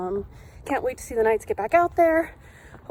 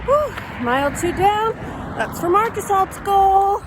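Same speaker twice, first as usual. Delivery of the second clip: high, louder mile-done cheer; excited; 8 semitones higher, 3 dB louder than usual. Real, not acted. Mile done, cheering goal while running.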